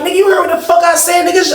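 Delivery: retold angry challenge. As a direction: bark it back like picking a fight. Heated confrontation before the fight.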